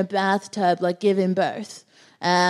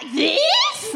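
mock high voice. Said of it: "you'd be like".

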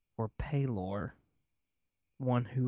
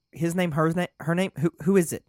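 higher and louder, hurried demand; urgent; 6 semitones higher, 8 dB louder, faster than usual. Acted. Lorik urgent, panicking.

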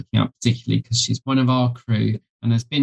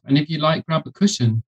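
ordinary talk, nothing marked

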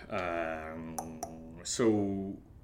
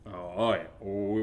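ordinary talk

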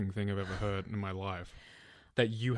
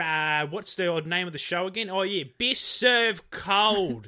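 higher, louder, slow broad Aussie bogan drawl; mock bogan Aussie; 7 semitones higher, 6 dB louder, slower than usual. Co-host parodying the show's intro in a slow bogan accent. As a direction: stretch it out like a dopey ocker host. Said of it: "we start off every episode going".

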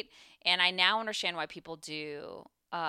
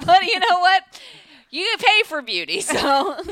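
laughing; 12 semitones higher, 15 dB louder than usual. Real, not acted. Laughing about hair and makeup price.

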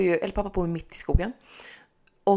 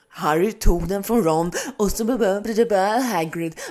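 mock audiobook reader; 5 dB louder than usual. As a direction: lightly mimic the narrator. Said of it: "Vaknar till liksom". (härmad ljudboksröst)

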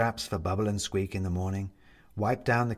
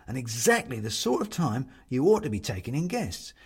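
cheerful Bobs; 3 semitones higher than usual. Acted. Bobs reassuring the ghost guest, cheery and quick. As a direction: fuss over the guest kindly. Bobs's speech.

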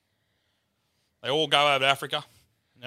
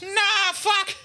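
very high, drawn-out mock wail; agonised comic confession; hammed-up sobbing, stretch the last words out; really crying; much slower than usual. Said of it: "Starts really fucking crying".

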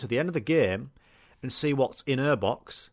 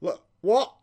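higher, louder startled blurt; mock startled fiancé; 8 semitones higher, 5 dB louder than usual. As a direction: jolt awake confused. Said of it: "He just wakes up".

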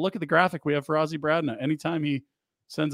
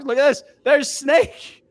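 playful excitement; 10 semitones higher, 5 dB louder, slower than usual. Real, not acted. Showing the Snake game video.

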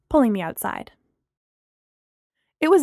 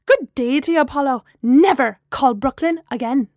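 loud, high-pitched indignant tirade; angry shouted rant; 4 semitones higher, 5 dB louder than usual. A narrator's reading. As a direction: let the outraged voicemail rant pour out. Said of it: "she shouted into the mouthpiece".

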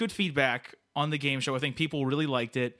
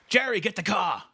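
calling out; 6 semitones higher, 5 dB louder than usual. Acted. Character exits, calling out.

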